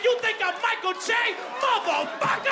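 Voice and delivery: high-pitched, furious comic outburst; mad, voice leaping way up; outraged yell. Acted. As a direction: explode in indignant shouting. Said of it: "I was so mad".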